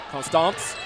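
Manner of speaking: attempted South Louisiana "Constance?", ending like "stahnce"; tentative South Louisiana pronunciation, rising; South Louisiana accent. Real, not acted. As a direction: try it the South Louisiana way, as a question. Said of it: "Trying to get the South Louisiana accent".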